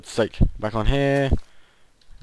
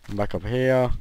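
ordinary delivery

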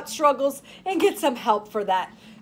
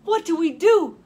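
worried; 6 semitones higher, 4 dB louder than usual. A narrator's reading. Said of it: "asked Lita".

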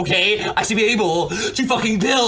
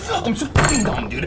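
normal talking voice

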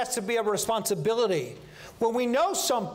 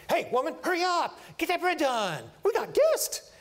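mock call to hurry the bread, rising high at the end; hammed-up Abraham hurrying his wife; bossy husband; 6 semitones higher than usual. Abraham calls to Sarah to hurry the bread, hammed-up.

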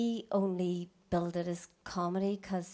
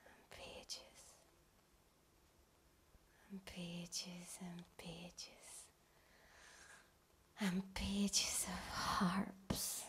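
slow, breathless whisper; awed whisper; 2 semitones lower, 18 dB quieter, much slower than usual. Acted. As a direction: whisper it like a guilty secret. Awed whisper about harp website.

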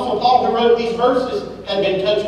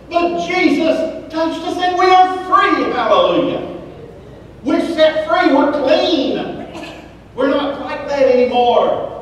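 fiery preaching; 6 semitones higher, slower than usual. Acted. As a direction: preach it out to the congregation, rising. Preaching at full force.

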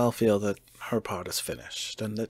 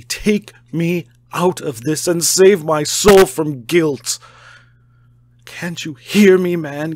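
high, loud desperate shouting; frantic shouted pleading; 4 semitones higher, 10 dB louder than usual. A narrator's reading. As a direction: scream the plea, climbing higher. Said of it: "torrent of entreaty".

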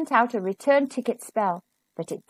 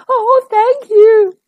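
loud, high, drawn-out thanks; mother monkey exclaiming gratefully; grateful cry; 9 semitones higher, 8 dB louder, slower than usual. A narrator's reading. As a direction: gush with relief. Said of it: "cried its mother".

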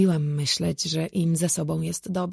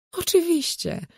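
matter-of-fact; 12 semitones higher than usual. A narrator's reading. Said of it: "jakby to było wiadome".

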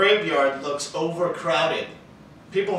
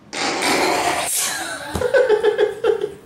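corny dad laugh; 6 dB louder than usual. Real, not acted. Laughs at stick joke.

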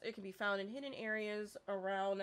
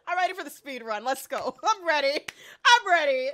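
loud, high, building to a shout; excited, laughing battle cry; fired up; 5 semitones higher, 13 dB louder than usual. Real, not acted. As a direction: psych yourself up for a race. Psyching up for a speed run.

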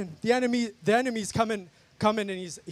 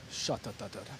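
speaking in tongues; 12 semitones lower, 13 dB quieter than usual. Real, not acted.

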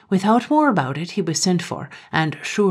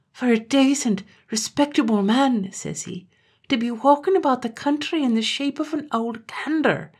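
mournful lament; 7 semitones higher than usual. Acted. Terence lamenting his father's fate.

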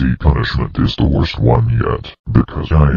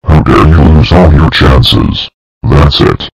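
much louder, furious telling-off; loud angry scolding; 9 dB louder than usual. Acted. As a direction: bark it out in outrage. Scolding after chances lost.

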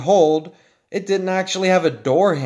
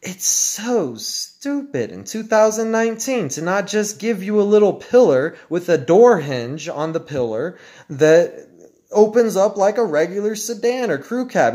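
ordinary talk

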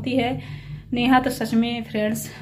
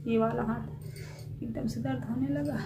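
pained, weak; 10 dB quieter than usual. Real, not acted. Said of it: "एक हाथ से दर्द होने लगा".

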